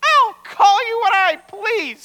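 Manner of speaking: high, loud, slow defiant puppet; insolent marionette. Acted. Marionette talking back to its father, insolent. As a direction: defy him in a high puppet voice. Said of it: "said the insolent marionette".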